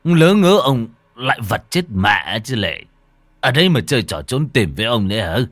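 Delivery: irritated snapping. Acted. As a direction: snap at the unseen ghost. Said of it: "Lão bực mình gắt lên".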